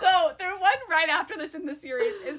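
high-pitched, talking through real laughter; helpless laughter. Real, not acted. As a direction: try to get the words out while cracking up. Cracks up saying the series title.

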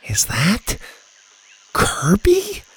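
questioning, leaping high on the name; startled disbelief. A narrator's reading. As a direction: rise sharply into a stunned question. Child recognises injured man in shock.

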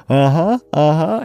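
rising, questioning uh-huhs with a hint of a smile; imitated smiling uh-huh. Host imitating the smiling woman in the scene, eager uh-huh. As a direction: lightly imitate a coy, smiling yes, rising at the end. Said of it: "like, smiles and, like, she's like".

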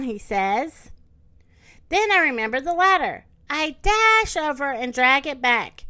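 slow, animated picture-book narration; expressive story time delivery; reading to children. Acted. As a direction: read it to little kids, drawing out key words.

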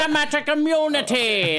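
high South African villain line; clipped South African movie villain; South African accent. Acted. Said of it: "any lines out of it".

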